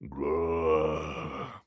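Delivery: low, long zombie growl; low grumpy undead growl. A narrator's reading. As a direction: growl it grumpily, deep in the throat. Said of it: "it growled grumpily".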